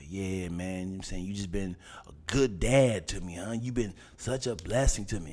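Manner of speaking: low, soft, laid-back son act; mock-sweet baiting. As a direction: imitate a son quietly baiting his dad. Retelling son baiting his father.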